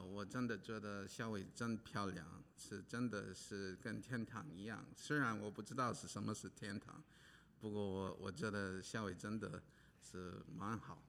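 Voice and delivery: usual speaking voice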